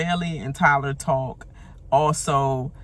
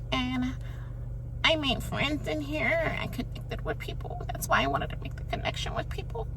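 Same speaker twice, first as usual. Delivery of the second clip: mocking Kevin's begging; 7 semitones higher than usual. Mocking Kevin pleading to stay another week, high whiny voice. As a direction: plead pitifully, high and hushed. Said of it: "bagging ass shit after that".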